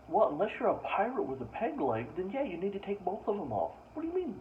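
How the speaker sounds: ordinary talk, nothing marked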